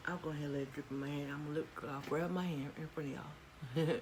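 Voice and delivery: ordinary talk, nothing marked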